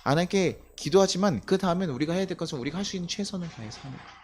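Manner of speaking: usual speaking voice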